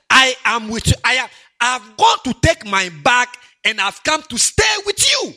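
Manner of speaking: loud, rising declaration, voice of God; booming promise. Acted. Preacher voicing God's promise to the grieving, booming and rising. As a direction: proclaim it like God's own vow.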